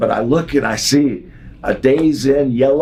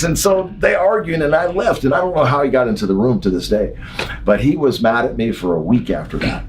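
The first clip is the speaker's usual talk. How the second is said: normal talking voice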